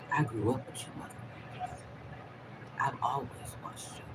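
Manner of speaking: hushed, menacing words to the feds; threatening whisper. Real, not acted. Vlogger warns the feds in a hushed threatening whisper. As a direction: breathe the threat low and quiet.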